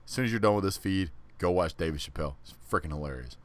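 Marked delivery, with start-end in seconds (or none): none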